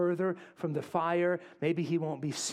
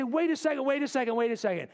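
high, rapid-fire interruption, stepping down; excited, repeated halt; sudden suspicion; 8 semitones higher, 3 dB louder, faster than usual. Acted. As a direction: break in as if just catching on. Bystanders catching Peter out, acted.

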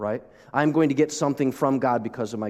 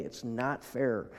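mock whiny child; 9 dB quieter, slower than usual. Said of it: "And that starts right away".

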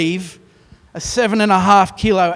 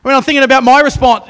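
worked up; 8 semitones higher, 10 dB louder, faster than usual. Real, not acted. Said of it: "you maybe get an insight into what happens in my household".

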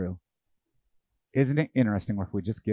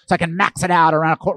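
higher, louder mock boast; outraged driver; 5 semitones higher, 8 dB louder than usual. Preacher mocks a reckless driver with a loud boast. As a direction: brag like a hot-headed driver.

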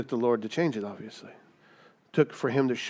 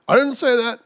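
high, louder protest; caught-out sinner denying it; defensive denial; 11 semitones higher, 8 dB louder than usual. Pretend. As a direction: squeak out a guilty denial. Acted denial to God.

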